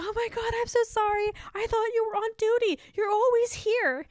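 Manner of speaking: high, gushing customer apology, slowing at the end; a customer caught out, begging pardon in a high voice. Retold or imitated. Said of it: "she greatly apologized".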